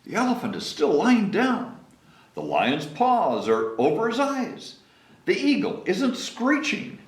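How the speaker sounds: high anxious listing; fretful keeper. Acted. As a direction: rattle it off worried. Keeper frets.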